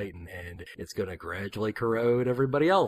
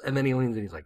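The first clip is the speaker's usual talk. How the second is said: usual speaking voice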